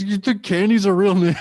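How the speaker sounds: real laugh breaking into the words; laughing disbelief. Real, not acted. Mocking the friend's hooker theory, laughing. Laughing at his own story.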